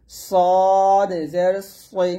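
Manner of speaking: সদ জের সই drawn out slowly with a heavy স; slow and full-mouthed; thick sad sound. Shown as a demonstration. Teacher drilling the thick Arabic sad for students. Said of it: "সদ হবে মোটা".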